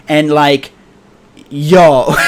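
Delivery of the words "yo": ordinary delivery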